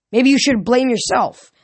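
Percy turning Hermes' anger on himself, defiant; defiant retort. Acted. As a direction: fire it back at the god. Percy snaps back at Hermes.

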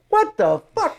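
a high, shocked what the fuck; shocked outburst. Retold or imitated. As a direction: react in total disbelief. Director's shocked reaction.

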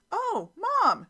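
high, loud mock squeal of delight; excited daughter. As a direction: imitate a delighted daughter calling out to mom. Retold daughter spotting the pillows.

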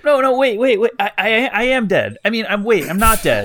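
loud, flustered denial; flustered panic. Acted. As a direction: scramble to explain, talking over yourself. Keth panics when confronted.

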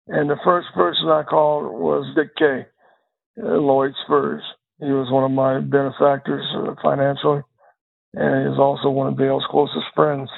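ordinary talk, nothing marked

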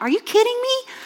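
high, shocked disbelief. Retold or imitated. Preacher reacting to friend's news in shocked disbelief. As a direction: squeal it in disbelief. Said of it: "And I'm like".

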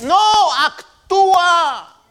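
very loud, high, drawn-out preacher's cry; shouted command. Real, not acted. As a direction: cut in and belt it out, stretching the word.

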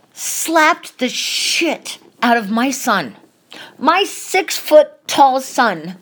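indignant, slowed-down fury; outraged. Real, not acted. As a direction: spit out each word in outrage. Furious retelling of son slapped.

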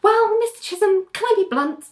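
high-pitched mimicked politeness; put-on polite character voice. Lawyer asks client to let her be blunt, high mock-polite.

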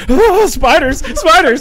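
high, loud mock alarm; imitated panic. Husband imitating wife scrambling down the bed, loud mock panic. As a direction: yelp it like a panicked sleeper.

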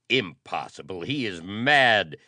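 loud protest rising to a high cry; shouted disbelief. A narrator's reading. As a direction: build to a shout of denial. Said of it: "shouted Paul".

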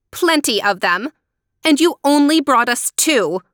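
scolding disbelief, building; angry cry. A narrator's reading. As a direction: throw it back in outrage. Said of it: "Helena cried angrily".

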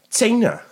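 China said high, as tsai-na; China with a ts sound at the start; Welsh-spelled China. Shown as a demonstration. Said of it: "China starts with a T and an S".

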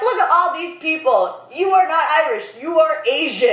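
mock cab driver, pitched high; quoting the cab driver. Friend imitates the driver pointing at people, high-pitched. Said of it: "And he was just like".

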